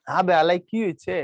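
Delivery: put-on a mild Old Dhaka accent demo; Old Dhaka dialect. Shown as a demonstration. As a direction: talk like an Old Dhaka local. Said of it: "এটা পুরান ঢাকার ভাষা".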